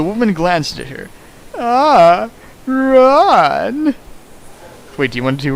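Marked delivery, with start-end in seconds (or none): 1.6-3.9 high, loud put-on lady's voice; a grand mistress greeting her servant; Yukari voice. Voicing Yukari in fanfic reading.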